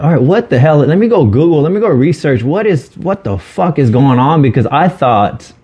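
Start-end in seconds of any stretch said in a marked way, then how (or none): 0.0-4.4 retold alarm. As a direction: replay the rising alarm of not knowing what's wrong. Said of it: "And I'm like".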